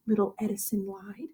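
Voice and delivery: hushed whisper of the narrator. A narrator's reading. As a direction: breathe it out as a secret. Narrator whispers the lie tag.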